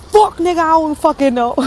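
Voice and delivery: high, loud exasperated outburst; panicked retort. Retold or imitated. Scared parent answers son, panicked outburst. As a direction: snap back in comic panic, high and loud.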